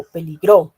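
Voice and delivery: peligro as peliGRÓ, last syllable punched loud; stress on final syllable. Shown as a demonstration. Teacher contrasts accents for students, stressing GRO in peligro. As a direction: hit the GRO at the end of peligro. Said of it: "la sílaba gro".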